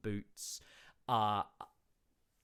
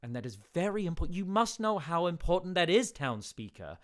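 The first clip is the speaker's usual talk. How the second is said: 1 urgent insisting; 7 semitones higher, 5 dB louder than usual. Acted.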